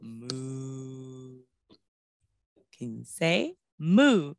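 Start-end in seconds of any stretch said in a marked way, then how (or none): 0.0-0.7 soft low moo; cow moo. Shown as a demonstration. Teacher showing children the cow sound, soft moo. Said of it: "what sound does the cow make".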